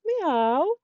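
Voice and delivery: high, loud little cat meow. Acted. Teacher voicing the story cat for kids. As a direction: mew like a small cat. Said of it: "and she said".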